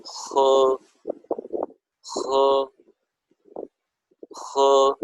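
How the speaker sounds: خو said with a rough throaty h, like a blend of ha and kho; Chinese h sound. Shown as a demonstration. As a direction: breathe خو out from the back of the throat. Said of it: "اس کو پڑھیں گے خو".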